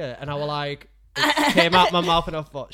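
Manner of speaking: ordinary talk, nothing marked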